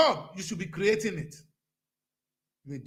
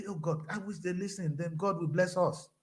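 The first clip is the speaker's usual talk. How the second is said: ordinary talk, nothing marked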